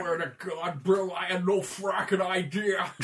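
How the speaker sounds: slow, loud gruff-officer impression; Colonel Ty. Officer swearing to Bill, slow and emphatic. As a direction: drag it out in exasperated disbelief. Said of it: "Colonel Ty from, uh, Battlestar Galactica".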